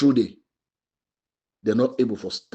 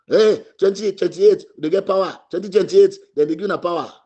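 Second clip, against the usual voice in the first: mock boast; 7 semitones higher, 4 dB louder than usual. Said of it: "they ask the question and they".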